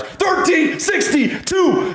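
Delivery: slow, high excited shout of the price. Real, not acted. Streamer shouting the new price to viewers, excited. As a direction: call the number out big and high.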